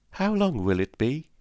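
put-on high-pitched child's question. A narrator's reading. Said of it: "the child asked".

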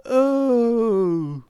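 long high wailing oh sweeping up and down; a drawn-out dismayed oh through the whole range; prolonged cry of dismay across the voice. A narrator's reading. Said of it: "he uttered a prolonged".

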